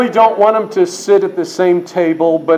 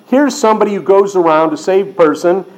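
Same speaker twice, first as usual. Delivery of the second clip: usual speaking voice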